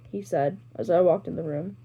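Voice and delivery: plain reading voice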